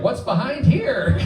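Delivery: put-on high, slow, wide-eyed toddler voice; a three-year-old marveling at a closed door; curious toddler. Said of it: "You're like".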